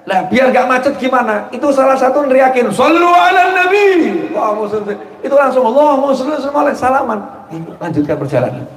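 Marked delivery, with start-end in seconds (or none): 2.7-4.3 high, slow drawn-out call; call to prayer blessing. Retold or imitated. Man in the jam calling the crowd to bless the Prophet, drawn-out high call. Street quarrel, someone calls out. 5.7-7.2 crowd's answer. Retold or imitated.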